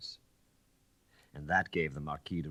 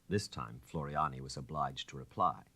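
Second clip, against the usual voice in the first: usual speaking voice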